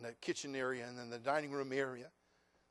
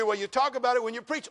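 listener's challenge; 8 semitones higher, 11 dB louder than usual. Acted. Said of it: "You say".

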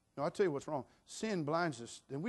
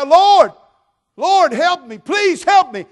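very high, full-voiced pleading shout; desperate cry; 24 dB louder, slower than usual. Blind Bartimaeus begging Jesus for help, desperate shouting. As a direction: cry out to be heard over a crowd. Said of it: "began to cry".

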